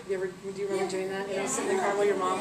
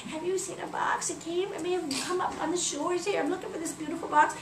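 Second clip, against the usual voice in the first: put-on old beggar lady; 6 semitones higher than usual. Disguised Isis asking everybody in town, old beggar lady. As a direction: ask timidly high, as an old beggar. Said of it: "disguised as an old beggar lady".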